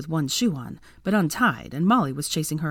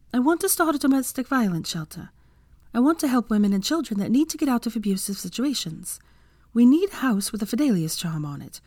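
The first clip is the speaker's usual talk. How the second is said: higher-pitched, earnest woman character; Hermione; 5 semitones higher than usual. Acted. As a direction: lay out a plan in a higher character voice. Hermione pitches her shelter idea.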